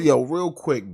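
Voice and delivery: usual speaking voice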